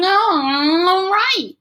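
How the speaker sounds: put-on a kid thrilled by the boom; excited shout. A narrator's reading. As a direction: cheer it out over the fireworks. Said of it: "Freak is shouting".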